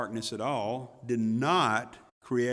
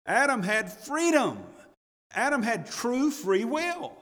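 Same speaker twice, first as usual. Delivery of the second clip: high, ringing preacher's climax; preaching at full force; 8 semitones higher, 3 dB louder than usual. Acted. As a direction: drive it home to the congregation.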